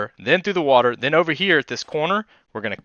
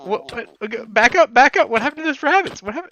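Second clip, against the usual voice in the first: mock shock; 7 semitones higher than usual. Mock interrogation about rabbits.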